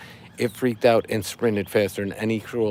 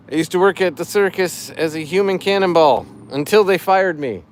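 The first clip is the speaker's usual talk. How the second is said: higher, louder corny fish puns; dad joke voice; 5 semitones higher, 5 dB louder than usual. Acted. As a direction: ham up the pun like a dad. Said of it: "I'm doing it in dad joke voice".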